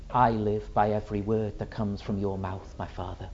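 low, soft prayer fading away; hushed devotion. Acted. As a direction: murmur it submissively to a father. Suffering Son answers his Father.

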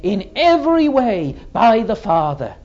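emphatic, ringing sermon delivery; measured, fervent pulpit oratory; fervent preaching. Acted. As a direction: preach it with weight on each phrase. Preaches to a congregation.

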